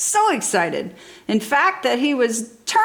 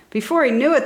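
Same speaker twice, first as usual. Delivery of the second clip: usual speaking voice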